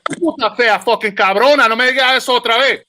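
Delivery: high, rapid-fire Spanish tirade; angry Spanish comeback. Acted. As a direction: fire back in fast, indignant Spanish.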